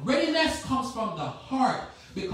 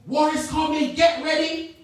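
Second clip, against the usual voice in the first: rising, louder call to arms; urgent war command; 5 semitones higher, 9 dB louder than usual. Acted. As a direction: sound the alarm for battle. Preacher voices the war command.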